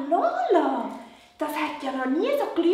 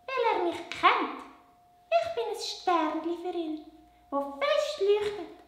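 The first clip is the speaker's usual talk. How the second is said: much higher little puppet voice; 6 semitones higher than usual. Acted. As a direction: puppet girl, pitched way up. Puppet Lola softly.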